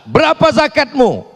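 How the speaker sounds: high, forceful mock interrogation; penanya tegas. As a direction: demand an answer from the worshipper. Acted questioner interrogates worshipper. (suara tinggi menginterogasi)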